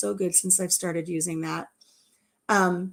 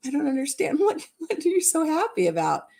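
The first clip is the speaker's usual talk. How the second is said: puzzled mother-in-law; 7 semitones higher than usual. Retold or imitated. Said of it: "my mother-in-law was just like".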